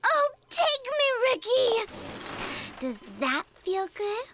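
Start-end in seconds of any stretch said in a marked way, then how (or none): 0.1-1.9 very high, lovestruck squeal; swooning rapture. Acted. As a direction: squeal it like a smitten fan. Smitten character throws herself at Ricky.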